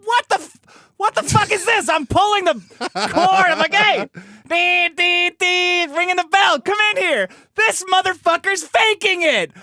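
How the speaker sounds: high, loud retold shouting at the fake; outraged yell. Yelling for the nurse at faker.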